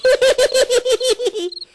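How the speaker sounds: boss's scoffing chuckle; amused laugh. Acted. Boss laughing at his nervous sidekick, scoffing chuckle.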